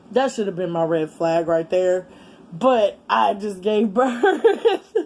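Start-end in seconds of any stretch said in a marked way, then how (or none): 2.5-5.0 slow words broken by a laugh. Real, not acted. Admitting she ignored the red flag, laughing. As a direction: laugh through the line.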